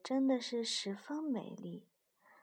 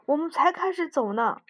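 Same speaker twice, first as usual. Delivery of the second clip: louder, higher exasperated yell; shouting; 7 semitones higher, 10 dB louder than usual. A narrator's reading. Mouse yells at frog for wanting lunch, loud. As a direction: shout it out in disbelief. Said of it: "老鼠大叫道".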